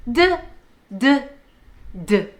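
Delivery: short, isolated "D" as "duh"; letter D sound. Shown as a demonstration. Teacher shows children the sound, short "duh" for "D". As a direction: say "D" as its sound, not its name. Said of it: "Say it with me".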